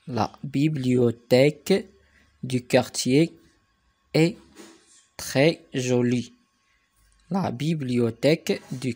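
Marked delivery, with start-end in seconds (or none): 0.1-6.3 slow, word groups set apart by pauses; slow dictation reading. Shown as a demonstration. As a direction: dictate it piece by piece for learners. Teacher dictates sentence in slow chunks. (إملاء بشوية، كلمة بكلمة)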